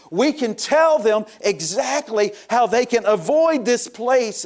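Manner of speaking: high-pitched, impassioned preaching. Real, not acted. Preacher telling the church how the lost avoid torment, impassioned preaching. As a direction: drive the good news home to the congregation.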